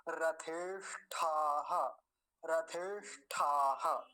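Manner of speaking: slow रथेष्ठाः with a strong puff on ठा; aspirated ठ. Shown as a demonstration. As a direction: push extra air into the ठ of रथेष्ठाः. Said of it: "ज्यादा वायु चाहिए होती है".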